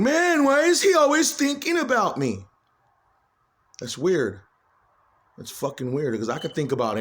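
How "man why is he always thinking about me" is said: raised, lightly mocking imitation of Danny; acting like him. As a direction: lightly ham up the complaining friend. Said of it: "acts like him saying".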